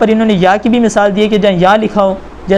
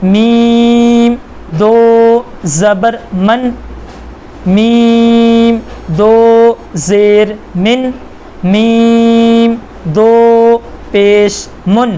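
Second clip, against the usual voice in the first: slow spelling drill; 3 semitones higher, much slower than usual. Shown as a demonstration. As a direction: spell each letter slowly like a teacher leading class. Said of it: "तनवीन के हिज्जे इस तरह करेंगे". (धीमी, खिंची हुई हिज्जे की आवाज़)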